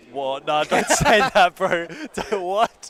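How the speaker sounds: loud protest. Real, not acted. Protesting the age teasing.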